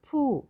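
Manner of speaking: फू high with a held ऊ; long vowel. Shown as a demonstration. Teacher showing the longer ऊ, vowel held long. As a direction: draw out the vowel of फू. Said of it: "थोड़ा अधिक समय लगता है".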